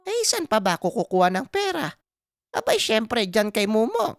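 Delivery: put-on high-pitched old mother, matter-of-course; Aling Pasing. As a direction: answer your son as if it were obvious, pitched high. (inang sumasagot na parang halata na, mataas ang boses)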